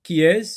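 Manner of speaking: qui EST-CE with a sharp rising question tune; teacher modelling the question qui est-ce; questioning rise. Shown as a demonstration. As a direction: ask qui est-ce loud and high, like quizzing the class. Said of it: "On pose toujours la question qui est-ce".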